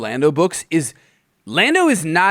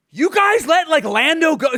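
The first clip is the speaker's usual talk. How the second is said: high-pitched scolding queen; mock outrage; 8 semitones higher than usual. Queen to guards who let Lando go, mock outrage. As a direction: act appalled at incompetent underlings.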